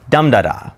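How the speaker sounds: dum-da-da, strong beat then two light; dactyl stress. Shown as a demonstration. Teacher showing how dactyl sounds, DUM-da-da. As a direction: hit "dum", trip lightly off "da-da". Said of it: "It sounds like".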